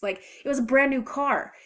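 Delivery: ordinary delivery, nothing marked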